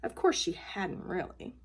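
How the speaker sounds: ordinary delivery, nothing marked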